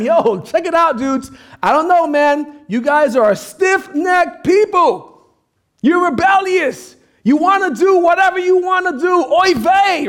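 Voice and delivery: high, loud, animated telling-off; hammed-up fed-up rebuke with punched words; exasperated scolding. Moses telling off the stiff-necked people, hammed-up exasperation.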